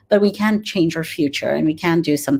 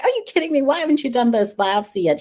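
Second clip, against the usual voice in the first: much higher, exasperated disbelief at a little brother; incredulous scolding; 8 semitones higher, faster than usual. Retold or imitated. Big sister demanding why her brother skipped the biopsy, exasperated. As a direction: throw the question at him in disbelief.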